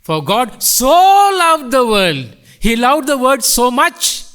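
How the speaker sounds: "so" stretched out, high and strong; stress on so. Shown as a demonstration. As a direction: draw out "so" with force. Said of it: "on the word so".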